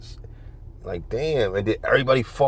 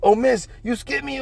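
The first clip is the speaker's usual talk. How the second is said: mimicking mom complaint; 11 semitones higher than usual. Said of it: "it'll always be like".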